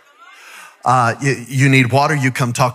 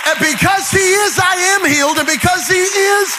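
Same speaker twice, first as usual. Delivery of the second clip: raised, shouting preacher's climax; full-force preaching; 8 semitones higher than usual. Acted. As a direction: build the room up and shout it out.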